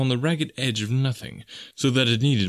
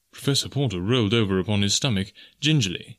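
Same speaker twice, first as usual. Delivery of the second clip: usual speaking voice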